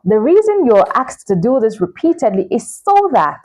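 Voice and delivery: ordinary talk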